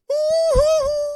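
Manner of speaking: high choked gasp, near tears; touched gasp. Retold or imitated. Receiving homemade chili, touched gasp. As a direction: gasp of being moved. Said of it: "And I was like".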